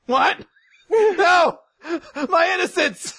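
very high, loud comic gasping outrage; mock scandalised. Student misreading teacher's request, mock scandalised. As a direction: cry out in hammed-up shock. Acted shock at misread line.

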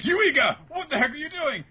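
high-pitched, loud mock outrage; angry scolding. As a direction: yell it like catching someone misbehaving. Acted scolding before a drop kick.

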